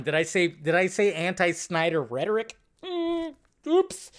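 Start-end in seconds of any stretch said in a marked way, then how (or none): 2.8-4.1 high, sheepish little "oops"; mock oops. Real, not acted. Vlogger catching his own anti-Snyder slip, mock guilty. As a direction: play caught out, teasingly.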